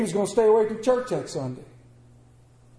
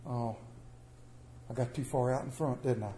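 much softer, lower, owning the mistake; soft rueful admission; 7 semitones lower, 12 dB quieter than usual. Pretend. Preacher showing the humble way to step back, soft and rueful. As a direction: sigh it out as a quiet realization. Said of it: "Step back and go".

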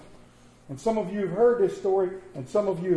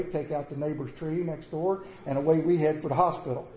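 normal talking voice

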